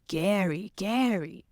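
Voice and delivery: put-on little kid saying Gary; child mispronouncing. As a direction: imitate a toddler's mispronunciation. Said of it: "they say".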